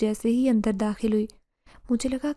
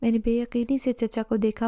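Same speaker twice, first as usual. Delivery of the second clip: ordinary delivery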